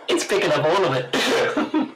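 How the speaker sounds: high, slow, laughing words; real amused laughter while talking. Real, not acted. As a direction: squeeze the words out through laughter. Listener cracks up at the gesture joke.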